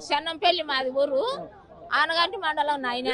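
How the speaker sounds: real, subdued tearfulness; softly tearful villager. Real, not acted. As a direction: answer low and soft, close to weeping. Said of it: "ఎందుకు ఏడుస్తున్నావు మరి".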